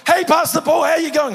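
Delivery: loud, high-pitched imitation of a booming greeting; imitated yell. Peter greets his pastor with no volume control, yelling. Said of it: "he would yell".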